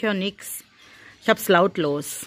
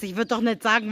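ordinary talk, nothing marked

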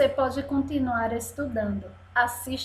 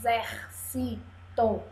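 softer "Exército" in four separate pieces; syllable by syllable; 7 dB quieter than usual. Shown as a demonstration. Teacher counts four little pieces, "Exército" split softly. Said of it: "Tem quatro pedacinhos".

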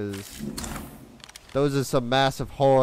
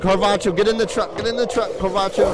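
raised, higher-pitched urgent order; urgent call; 7 semitones higher, 3 dB louder than usual. Real, not acted. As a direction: hurry your companion into the truck. Urging companion into truck under attack.

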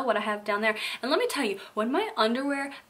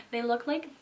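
normal talking voice

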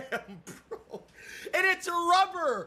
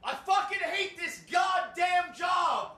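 slow, drawn-out exasperated shout; fed-up outburst; 3 semitones higher than usual. Real, not acted. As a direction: shout your hatred of the job, dragging it out. Rant about the job.

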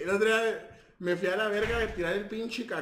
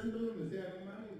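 ordinary delivery

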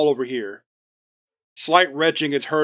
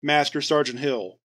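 ordinary delivery

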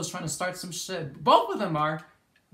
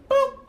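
very high, loud playful boop; cartoon boop sound effect; squeaky boop; 8 dB louder than usual. Real, not acted. Landing final hit.

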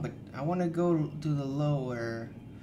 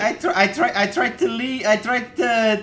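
loud, high stammering protest; flustered protest; 8 semitones higher, 10 dB louder than usual. Real, not acted. Player excusing themselves after the scare, flustered protest. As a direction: stammer it out, startled and raised.

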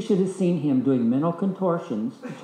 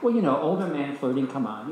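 ordinary talk

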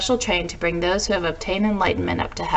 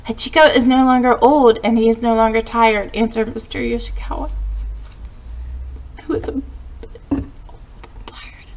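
real choked-up reading that falters; fighting back tears; 4 semitones higher than usual. Real, not acted. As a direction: read on until tears stop you. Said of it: "I've got myself back together".

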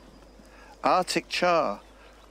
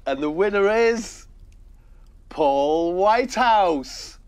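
slow, drawn-out winner reveal; pause, then announce the name; suspenseful awards presenter; 5 semitones higher, 4 dB louder, slower than usual. Pretend. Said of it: "time for the Gone Fishing Awards ceremony".

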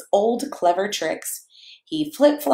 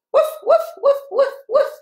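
high playful woofs; bright bouncy barking; short happy barks; 4 dB louder than usual. Pretend. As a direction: yap like a happy little dog. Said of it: "Can you do some short, happy barks?".

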